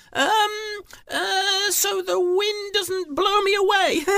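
very high, loud nervous squeak; stammering thief. Acted. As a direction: stall and stammer in a tiny high voice. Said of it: "She stuttered and she stammered".

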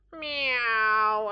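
loud, high, drawn-out meow; cat's meow. Acted. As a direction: let out a long loud miaow. Storyteller voices the cat.

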